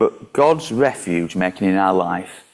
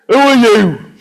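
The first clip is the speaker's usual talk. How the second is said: put-on loud, high-pitched northern accent; startled yell with a northern accent; 12 semitones higher, 9 dB louder, slower than usual. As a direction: shout it out in a broad northern accent. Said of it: "Perhaps not in that northern accent".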